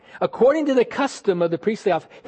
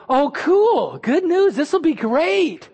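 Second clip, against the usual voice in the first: higher, mock-cheerful delight; 6 semitones higher, slower than usual. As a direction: play the naive optimist, lightly. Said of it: "you're not thinking".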